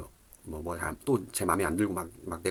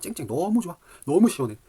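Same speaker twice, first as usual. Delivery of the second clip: delighted; 9 semitones higher, 6 dB louder than usual. Retold or imitated. Enjoying sunny delivery day.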